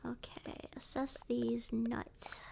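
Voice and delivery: a slow, hushed whisper; a slow whispered joke. Real, not acted. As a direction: drag the words out under the breath.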